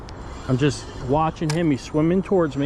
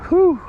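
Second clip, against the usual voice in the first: breathless whew; 14 semitones higher, 8 dB louder than usual. Real, not acted. Exhale after landing fish.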